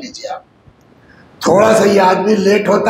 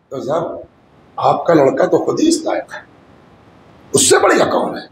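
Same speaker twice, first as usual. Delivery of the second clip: soft, lower wheedling tone; sly flattery; 3 semitones lower, 3 dB quieter, faster than usual. Retold or imitated. Amr al-As flattering Abu Musa's son, soft and wheedling. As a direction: flatter him quietly.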